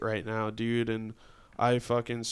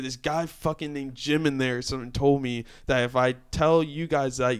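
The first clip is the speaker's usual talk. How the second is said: ordinary delivery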